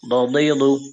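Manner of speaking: usual speaking voice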